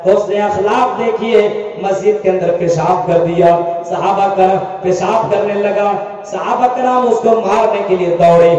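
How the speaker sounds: ringing, chant-like preaching; preacher telling a sacred story; impassioned sermon. Real, not acted. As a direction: drive the story home with sustained phrase endings.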